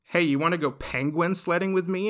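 eager, playful invitation; lightly acted cheerful kid; super cheery. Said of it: "he's super cheery".

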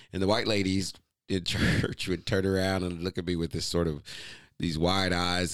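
ordinary delivery

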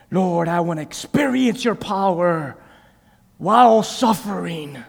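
slow, drawn-out plea to God; acted kneeling prayer. Preacher acting out an uncomfortable prayer on his knees, slow pleading. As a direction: pray it out loud, stretching the words. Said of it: "get on my knees? Come here. And just like".

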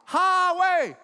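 "Highway" shouted high and stretched; emphatic HIGHWAY. Real, not acted. Preacher telling y'all where it was, shouted "Highway". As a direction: hammer "Highway" loud and high. Stressing the danger again.

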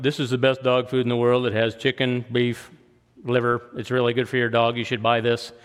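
lightly voiced dull pitchman, monotone; droning ad voice. Preacher mimicking a boring TV dog food ad, droning. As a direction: drone it like a bored dog food salesman. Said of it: "he's droning on".

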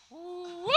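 high, rising "woo"; soft airy swoop upward; imitated whoosh. Shown as a demonstration. Imitates word blown into air.

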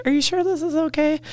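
small, high, soft voice; timid questioning. Retold or imitated. Women following boyfriends into the backcountry, timid and unsure. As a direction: ask it hesitantly, as if without a voice. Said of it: "not feeling like they had the voice".

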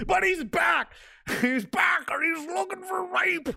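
gleeful hype. Pretend. Hyping his old comedy character's return.

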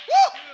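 short, high "woo"; loud high-pitched whoop after preaching; excited whoop. Real, not acted. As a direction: let out a quick, high cry.

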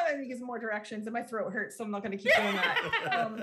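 usual speaking voice

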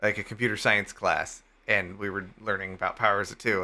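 normal talking voice

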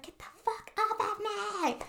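high mock scream, held back in volume; retold scream. Host imitates the girl being attacked upstairs, mock scream. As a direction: imitate a screaming woman in a thin high pitch, drawn out. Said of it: "screaming, and she was like".

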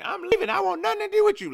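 high-pitched, rushed imitation of angry wife; hammed-up breakup outburst; mock storming off. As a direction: fling the threat out in a squeal.

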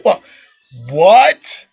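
incredulous double take; disbelief. Retold or imitated. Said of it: "I'm like".

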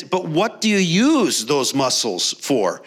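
slow, insistent retold question leaning on "use"; pressing question. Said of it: "he just keeps pressing and says".

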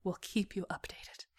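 slow, hushed whisper; whispered echo. A narrator's reading. Liz recalling the captain's words, whispering. Line echoed back in whisper.

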